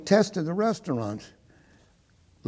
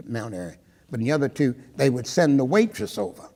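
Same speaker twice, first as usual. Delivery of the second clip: ordinary talk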